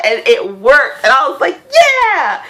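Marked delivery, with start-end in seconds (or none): 1.7-2.4 high, loud victory shout; triumphant whoop. Retold or imitated. Retelling her spell finally working, high loud triumphant whoop. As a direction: cheer like you just won.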